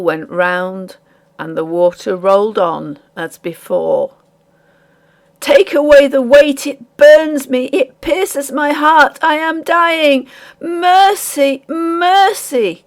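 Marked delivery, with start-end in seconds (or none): 5.4-12.8 high, loud and slow pleas for mercy; agonized cry. A narrator's reading. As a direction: beg as if the weight is crushing you. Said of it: "cried Frank".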